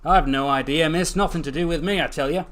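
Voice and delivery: indignant shopkeeper backing away from trouble; defensive denial. Acted. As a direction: insist you know nothing. Chopper defensively denies any involvement.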